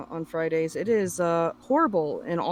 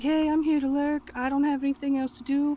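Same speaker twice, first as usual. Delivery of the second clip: imitated chat greeting; 7 semitones higher than usual. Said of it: "I'm like".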